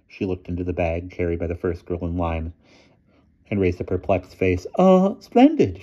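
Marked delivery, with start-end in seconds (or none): 4.8-5.8 high, slow, hesitant headmistress; doubtful praise in a slow falsetto; dubious. A narrator's reading. Headmistress puzzled by the bag, dubious high voice. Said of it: "she said dubiously".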